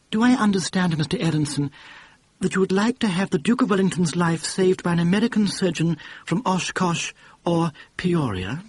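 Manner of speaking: put-on prim, ultra-refined English accent; polite, refined English accent correcting the boss; refined English accent, lightly done. A narrator's reading. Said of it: "ultra-refined accent".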